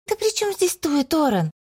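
high, irritated retort. A narrator's reading. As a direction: snap at her brother. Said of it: "Опалив брата гневным взглядом". (раздражённо)

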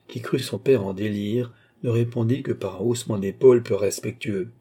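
usual speaking voice

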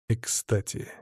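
ordinary delivery

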